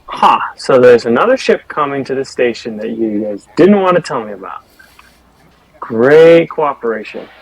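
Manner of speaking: loud, scornful character reaction; mock-impressed and loudly accusing; sarcastic indignation. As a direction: call them out, drawing out the final jab. Player voices Grace learning of secret.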